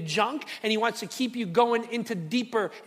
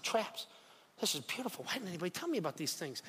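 hushed delight; 2 semitones lower, 13 dB quieter, faster than usual. Retold or imitated. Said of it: "I said".

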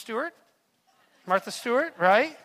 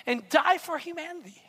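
ordinary delivery

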